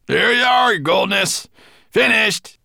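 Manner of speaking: loud, high triumphant shout; dwarf crying out. A narrator's reading. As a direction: call up to the master that the job is done. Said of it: "he cried".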